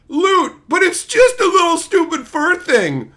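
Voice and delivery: loud, high, hammed-up excitement; mock excited reveal. Mocking the writers, hammed-up excited reveal. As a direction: announce the loot like a great prize.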